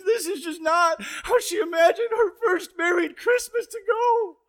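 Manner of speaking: high-pitched, hammed-up tearful whine; tearful mock wail. Husband mimicking his tearful new bride, mock wailing. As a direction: mimic an upset bride wailing in exaggerated despair. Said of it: "storms out of the living room in tears".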